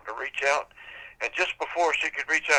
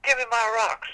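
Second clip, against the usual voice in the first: high, loud shrieking demand; demanding witch; 11 semitones higher, 4 dB louder than usual. Acted. Witch screams at the boy to hand back her rocks. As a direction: screech it up the tree. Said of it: "she said".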